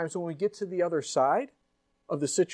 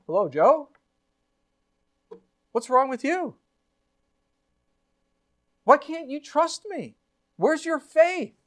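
God rebuking; 8 semitones higher, 6 dB louder than usual. Acted. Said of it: "And then the Lord goes".

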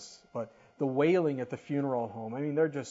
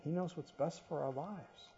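ordinary delivery

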